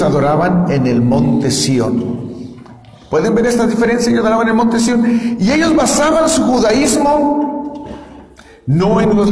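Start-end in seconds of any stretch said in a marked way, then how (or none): none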